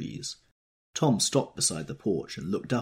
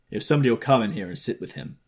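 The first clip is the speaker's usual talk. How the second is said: fast, louder curt command; fast and louder, taking charge of the room; authoritative snap; 5 semitones higher, 7 dB louder, faster than usual. A narrator's reading. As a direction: bark the order to get it done. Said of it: "he snapped authoritatively".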